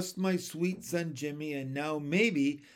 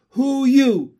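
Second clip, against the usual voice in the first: high, loud demand; frog husband challenging; 8 semitones higher, 14 dB louder than usual. Acted. Frog husband challenges surrounding froglets, loud and high. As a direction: call it out sharply, high and loud.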